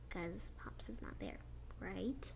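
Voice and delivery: soft unvoiced whisper, sorrowful; sad whisper. A narrator's reading. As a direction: hush it like a sad secret. Sad loss of Pops.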